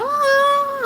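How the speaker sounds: high wailing "ah"; mock scream. Reader re-enacts screaming in her car, mock wail. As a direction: act out a crying scream, high-pitched. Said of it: "I'm screaming stuff".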